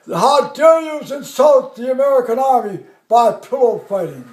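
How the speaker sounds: loud, indignant dressing-down, dropping at the end; colonel's mock rebuke. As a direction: imitate a colonel scolding his troops. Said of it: "the colonel's there".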